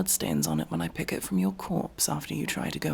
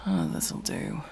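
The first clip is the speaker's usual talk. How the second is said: muttered aside; 7 semitones lower, slower than usual. Acted. Muttering to self at camp.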